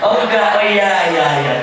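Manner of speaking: high, loud exclamation; answering the audience loudly; loud reply. Real, not acted. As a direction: call it out.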